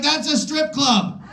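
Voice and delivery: high, slow deadpan squeak; hearing the shop's name, high and slow; mock blurt. Naming The Crystal Palace.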